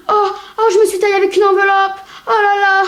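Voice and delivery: high, fast, hammed-up whimpering; whiny sick boy.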